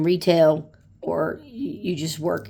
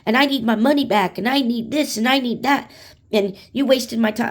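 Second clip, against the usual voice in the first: higher pitched, pushy complaint; a customer lists demands at the front desk, mock angry and higher; 6 semitones higher than usual. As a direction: imitate an irate customer listing demands. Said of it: "they'd get pissed and go".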